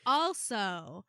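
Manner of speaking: loud, spelled-out, slowing to a drawl; over-the-top acrostic recital; mock acrostic. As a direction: ham up a silly acrostic. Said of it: "waiting for it to be like".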